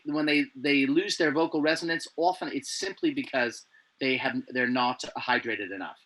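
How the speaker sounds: ordinary talk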